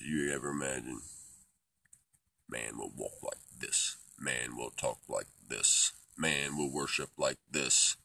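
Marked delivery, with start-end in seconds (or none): 2.5-8.0 slow, measured chant building to very loud; commanding proclamation. Pretend. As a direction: proclaim each line louder and slower. Proclaims his will in rising chant.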